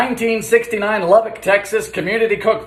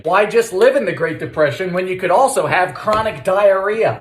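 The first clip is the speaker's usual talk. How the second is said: ordinary delivery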